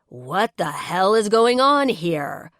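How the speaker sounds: loud, slow furious roar. A narrator's reading. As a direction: roar it at them, outraged. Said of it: "The roar comes from behind me".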